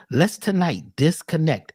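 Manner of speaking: usual speaking voice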